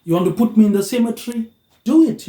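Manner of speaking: defiant dare. Pretend. Facing the fear of the cemetery, defiant rising shout. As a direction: dare death to come and get you. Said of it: "you gotta be like".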